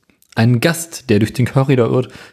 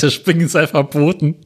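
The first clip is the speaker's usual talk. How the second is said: ordinary talk, nothing marked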